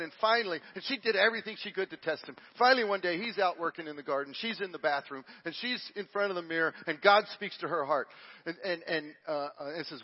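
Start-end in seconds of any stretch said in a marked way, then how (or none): none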